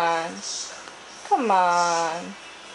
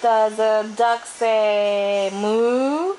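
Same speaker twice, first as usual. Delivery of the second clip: put-on reading to a toddler; 3 semitones higher, slower than usual. Quizzing toddler on animal sounds.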